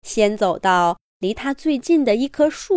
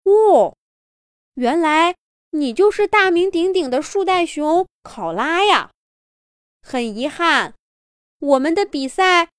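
raised, eager explaining voice; friendly staff voice; 5 semitones higher than usual. Acted. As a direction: hurry to explain kindly. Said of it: "赶紧回答说". (着急解释的工作人员)

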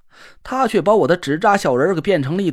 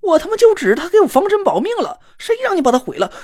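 angry yelling; 8 semitones higher than usual. Acted. Narrator yelling at Na Ruolan over the broken paper figure, furious. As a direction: yell at him for wrecking your charm.

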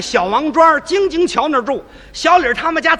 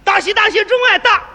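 big-voiced congratulations; 8 semitones higher than usual. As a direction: bellow the good wishes at the door. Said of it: "大嗓门道喜嚷啊". (高声大嗓门道喜)

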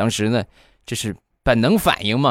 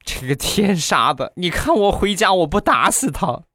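mock tearful rant; 9 semitones higher than usual. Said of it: "就过去找我媳妇儿就哭诉".

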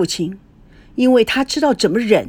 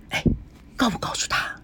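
whispered question; 8 dB quieter, faster than usual. Acted. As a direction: whisper it so the grandson won't hear. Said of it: "小声地问". (压低声音的耳语)

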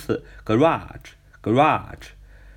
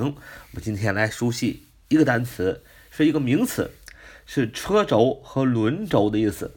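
ordinary delivery

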